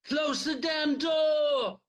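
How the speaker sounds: very high, slow drawn-out scream; screamed command. Acted. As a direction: scream it while losing ground. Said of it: "Tuck screamed".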